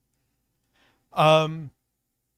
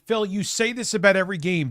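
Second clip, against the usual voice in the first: louder, pressing scold at Phil; frustrated rant; 5 semitones higher, 4 dB louder, faster than usual. Retold or imitated. Streamer scolding Phil again, heated but less loud. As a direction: push it at him like a lecture. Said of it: "I just wanna".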